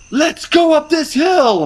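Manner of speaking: high, slow, eager rallying call; rally them up the hill; gung-ho leader voice. Retold or imitated. Confident leader rallying the team.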